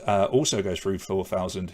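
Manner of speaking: ordinary talk, nothing marked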